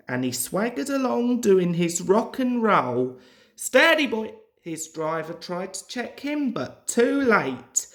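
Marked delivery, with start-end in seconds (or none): 3.5-4.3 much higher, louder warning call. A narrator's reading. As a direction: check him sharply, loud and high. Driver checking engine.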